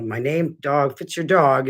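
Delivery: ordinary delivery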